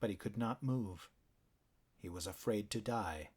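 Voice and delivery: ordinary delivery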